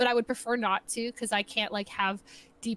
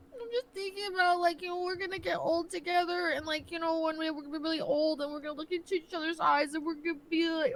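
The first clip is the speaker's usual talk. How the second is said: tearful; 5 semitones higher than usual. Acted. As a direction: gush through mock tears about growing old together. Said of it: "Are you crying".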